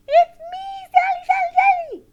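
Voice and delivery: very high, loud puppet squeal; wound-up squeaky puppet; excited puppet. Pretend. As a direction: shriek the name as a hyper puppet. Overexcited puppet Sally announces itself.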